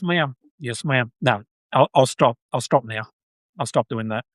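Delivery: ordinary delivery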